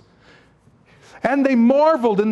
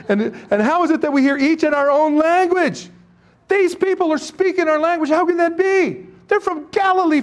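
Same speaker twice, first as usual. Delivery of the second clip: astonished crowd voice; 7 semitones higher than usual. Acted.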